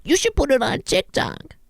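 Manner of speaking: put-on chirpy kid pitching an idea; young kid telling the execs to post it on TikTok; mocking a young kid. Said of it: "kids my age who came up".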